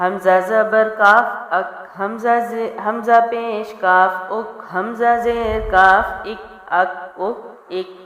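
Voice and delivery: slow chant of اک with the kaf stopped, no bounce; unbounced kaf. Shown as a demonstration. Teacher warns students not to bounce kaf, slow drill. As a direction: stop on اک, no bounce. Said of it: "bounce نہیں کرنا".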